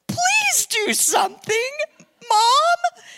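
high, strained, drawn-out plea; hammed-up desperate whine; imitating pleading passengers. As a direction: beg the mother as a whole exasperated plane. Voicing exasperated passengers over screaming baby.

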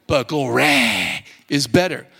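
GLORY stretched to glo-RAAY in preacher accent. Shown as a demonstration. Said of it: "use a preacher accent".

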